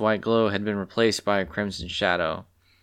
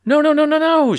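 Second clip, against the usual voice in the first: loud, much higher squawk of dismay; squawking panic; 8 dB louder than usual. Acted. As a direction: shriek it while running late. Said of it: "she squawked at the top of her lungs".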